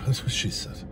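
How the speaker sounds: usual speaking voice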